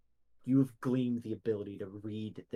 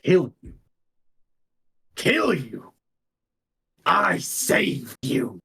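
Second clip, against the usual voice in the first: loud, higher scoffing repeat, then slow; indignant outburst; 3 semitones higher, 8 dB louder, slower than usual. Acted. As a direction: throw the accusation back at him. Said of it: "He goes".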